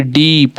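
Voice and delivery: "डीप" with a lengthened ई; long drawn vowel. Shown as a demonstration. Teacher demonstrating double-e for learners, long drawn vowel. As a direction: hold the vowel of "डीप" before closing on प. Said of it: "थोड़ा सा लंबा बोल रहे हैं".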